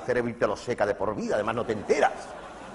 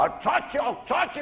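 much higher yelled slogan; mock shouting president; 14 semitones higher, slower than usual. Comedian imitating the ex-president shouting, high yells. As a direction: shout it with spit flying.

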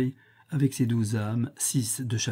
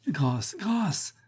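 pained cry for mercy; 7 semitones higher than usual. A narrator's reading. Death feeling her old bones burn, crying for mercy. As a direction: cry out as if being burned. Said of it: "s'écria-t-elle en sentant brûler ses vieux os".

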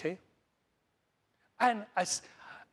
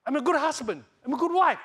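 higher and louder, proclaiming it; loud proud declaration; 9 semitones higher, 7 dB louder than usual. Shown as a demonstration. Pastor leading the congregation in affirmations, loudly proclaiming. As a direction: declare it boldly to the room. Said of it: "Talk to yourself".

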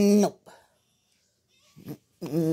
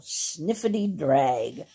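ordinary delivery

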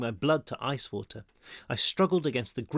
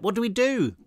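high-pitched, urgent plea; raised, desperate question; 8 semitones higher, 4 dB louder than usual. A narrator's reading. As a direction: demand an answer in desperation. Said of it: "his voice laced with desperation".

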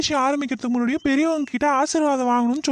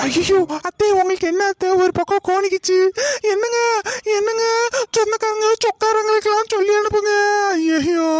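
fake panic; 9 semitones higher than usual. Daughter-in-law pretends panic, calling relatives for her mother-in-law. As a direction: overact fearing she is dying. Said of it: "உன் நடிப்பெல்லாம் உன் வாயாலே வர வைக்கணும்".

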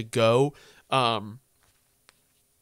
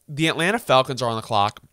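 normal talking voice

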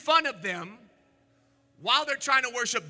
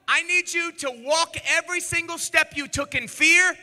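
preaching rebuke; 7 semitones higher, 5 dB louder than usual. Acted. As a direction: thunder the rebuke from the pulpit.

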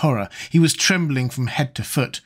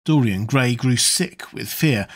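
plain reading voice

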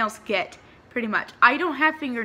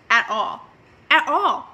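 emphatic; 5 semitones higher, 4 dB louder than usual. Real, not acted. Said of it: "stressing nobody heard it".

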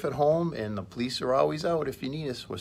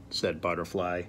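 ordinary delivery, nothing marked

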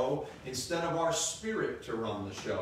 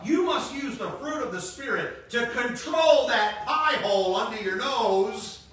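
loud, slow, driving sermon voice; preaching at full force; 8 semitones higher, 6 dB louder than usual. Acted. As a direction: pound it home from the pulpit.

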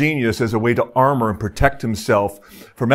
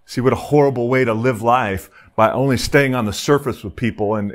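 ordinary talk

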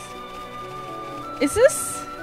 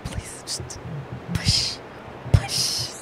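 breathy whisper, almost no voice; 6 semitones lower, 11 dB quieter, much slower than usual. Acted. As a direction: whisper it like a secret plea. Urging a shove off the cliff.

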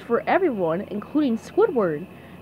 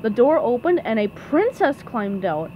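ordinary delivery, nothing marked

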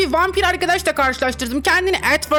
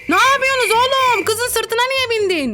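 mock outrage; 8 semitones higher than usual. Avatar outraged at being ridden.

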